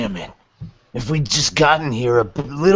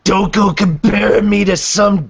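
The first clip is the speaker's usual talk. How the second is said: angry; 8 semitones higher, 5 dB louder than usual. Acted. Angry outburst.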